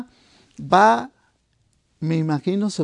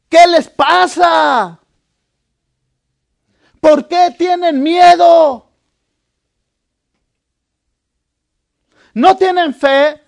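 loud rebuke; 7 semitones higher, 8 dB louder, slower than usual. Retold or imitated. Jesus rebuking frightened disciples.

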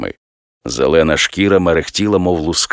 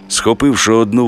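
ordinary delivery

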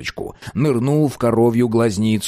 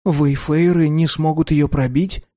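put-on child voice; 6 semitones higher than usual. A narrator's reading. Boy voiced in story.

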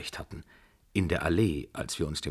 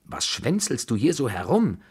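high, swooping indignant question; mock the hanger-on sharply; scornful scolding; 7 semitones higher, 4 dB louder than usual. A narrator's reading. Grandmother taunting Mademoiselle Blanche, scornful scolding.

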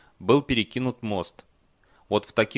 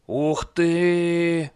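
higher, louder, stretched-out wow; breathed-out amazement; 7 semitones higher, 5 dB louder than usual. A narrator's reading. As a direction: marvel slowly as the awestruck troll. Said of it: "выдохнул он". (изумлённый протяжный возглас)

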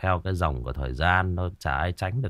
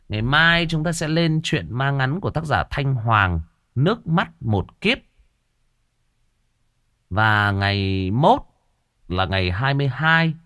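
higher, louder announcer read; program announcement; 6 semitones higher, 6 dB louder than usual. Acted. Host announcing next days' ghost stories to listeners, upbeat announcer voice. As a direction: sell the coming episodes brightly.

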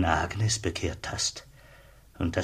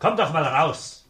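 higher, loud call to someone inside; 8 semitones higher, 4 dB louder than usual. Acted. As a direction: call him out of the house. Zöllner ruft Kollegen herbei. (laut hineinrufen)

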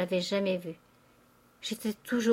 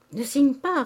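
high, loud warning; urgent cry; 6 semitones higher, 6 dB louder than usual. A narrator's reading. Father begging his daughter not to sign, crying out. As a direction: cry out to stop her at once. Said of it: "criait le père Goriot".

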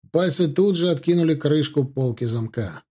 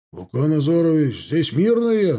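raised call, climbing in pitch; call from indoors; 2 semitones higher, 4 dB louder than usual. A narrator's reading. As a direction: call out a report to the sergeant. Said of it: "донёсся крик из глубины дома".

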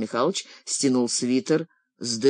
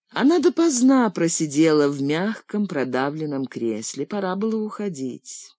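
usual speaking voice

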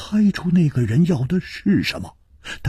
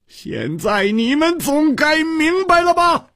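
much higher, hoarse crying-out plea; desperate shout; 13 semitones higher than usual. A narrator's reading. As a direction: scream it out in despair. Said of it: "嘶着声音喊道".

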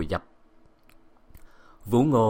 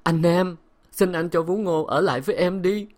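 higher, imploring wife; pleading; 8 semitones higher than usual. A narrator's reading. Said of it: "nài nỉ".

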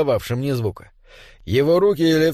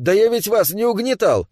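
higher-pitched protest; objecting; 6 semitones higher than usual. A narrator's reading. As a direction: object to an unfair accusation. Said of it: "возразил Дэвид". (возражая, протестующе)

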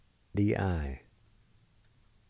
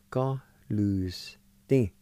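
slow demo; much slower than usual. Shown as a demonstration. Teacher to students modelling the word, very slow. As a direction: Go lose thing spoken very slowly and clearly. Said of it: "like this".

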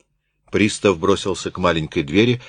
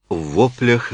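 ordinary delivery

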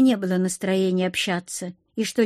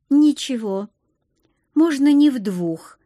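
higher, louder insistence; not giving in; 4 semitones higher, 4 dB louder than usual. A narrator's reading. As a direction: mildly refuse to be put off. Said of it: "не поддалась я". (настойчиво, не поддаваясь)

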